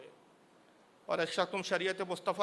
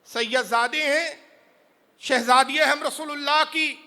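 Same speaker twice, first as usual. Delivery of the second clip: higher, louder, slow anguished wail; tearful wail; 7 semitones higher, 12 dB louder, slower than usual. Acted. Old widow weeps as she tells the stranger her lineage, anguished. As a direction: cry out the family's lost honour. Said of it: "ضعیفہ رونے لگی".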